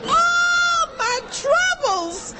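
very high, slow, wailing mock shout; husband's mocking wish. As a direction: stretch it out in a shrill crying mock. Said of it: "He says".

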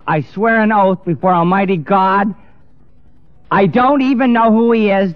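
high, insistent retold oath; emphatic oath. Peter's oath, replayed at full force.

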